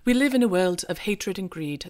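a clumsy put-on British accent, reading a speech; hammy British accent, like a bad Hugh Grant; amateur British accent reading a film monologue; terrible Hugh Grant British accent. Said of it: "this terrible British accent".

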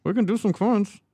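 muffled, hollow cup voice; talking into a cup. Real, not acted. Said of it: "going on with your voice?".

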